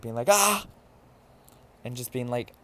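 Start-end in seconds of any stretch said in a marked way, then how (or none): 0.3-0.7 short, loud high-pitched shriek; sudden yell to make someone jump; mock scare scream. Imitating drunk self scaring people on the street, mock scream. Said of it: "scaring people on the street".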